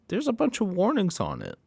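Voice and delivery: mock clerk voice.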